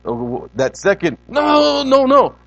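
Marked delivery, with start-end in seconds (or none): 1.3-2.3 loud, high-pitched parental no; acted parent who really means it; high intense no. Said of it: "That's a little more high intense".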